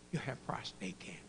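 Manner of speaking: whispered, low and grave; hushed grave news. Retold or imitated. Doctor tells patient he has cancer, whispered. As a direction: break bad news in a whisper.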